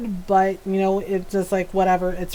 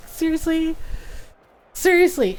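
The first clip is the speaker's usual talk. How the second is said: high, incredulous seriously; exasperated disbelief; 8 semitones higher than usual. Retold or imitated. Mom asking her daughter in disbelief, exasperated. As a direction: ask it twice in fed-up disbelief. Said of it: "I'm just like".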